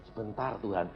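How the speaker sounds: soft, low reply, half mumbled; reluctant plea. Retold or imitated. Pastor stalling God when called to pray, reluctant plea. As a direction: put God off quietly and low.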